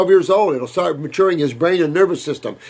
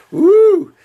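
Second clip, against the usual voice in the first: high, loud, delighted woo; joyful whoop; 7 dB louder than usual. Real, not acted. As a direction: let out a happy cry at the hug. Cocoon hug from caller.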